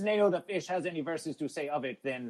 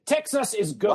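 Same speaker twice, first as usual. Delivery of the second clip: loud, raised-pitch declaration; imitated defiant proclamation; mock proclamation; 5 semitones higher, 7 dB louder than usual. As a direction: announce it like a rallying cry. Acts out a chat declaration.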